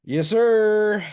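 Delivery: loud, high, drawn-out holler; hyped-up. Real, not acted. Host cheering the plan for next week, hyped-up. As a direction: ring it out like a hype man.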